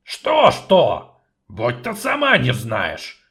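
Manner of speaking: loud, grumpy dwarf voice; grumbling dwarf. Acted. Dwarf grumbling at the sorceress, loud and gruff. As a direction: grumble it out irritably. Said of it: "проворчал гном".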